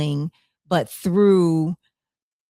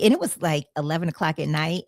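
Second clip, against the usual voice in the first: ordinary talk, nothing marked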